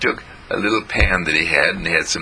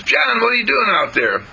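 put-on very high, louder girl's call; little girl calling out; 3 dB louder than usual. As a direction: sister Jean calling from the porch. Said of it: "she called out".

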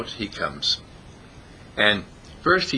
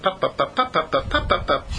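rapid string of higher pops; popping noises; 8 semitones higher than usual. Acted. Bunny gives birth to a dozen, rapid popping sounds. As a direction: pop each one out evenly, pitched up. Imitates bunnies being born.

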